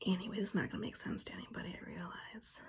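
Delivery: quick unvoiced whisper; fast self-conscious whisper; whispered aside. Real, not acted. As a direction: mutter the aside under your breath. Aside to camera.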